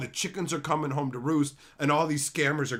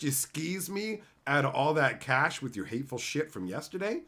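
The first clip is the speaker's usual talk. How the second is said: ordinary talk, nothing marked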